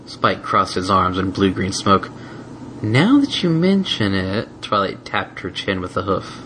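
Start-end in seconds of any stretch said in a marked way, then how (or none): 2.8-4.5 teasing musing. Acted. Twilight teasing thoughtfully.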